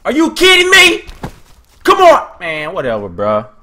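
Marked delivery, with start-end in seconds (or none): 0.0-2.4 very high, loud protest; high frustrated outburst; exasperated yell. Real, not acted. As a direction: shout it in outraged disbelief. Frustrated in game fight.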